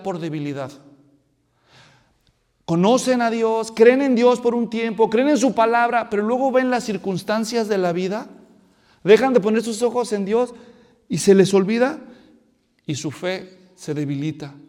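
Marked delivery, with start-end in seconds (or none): 2.6-12.0 loud fervent preaching; preaching at full force. Acted. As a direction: drive it home to the congregation. Predicación intensa. (predicación con fuerza)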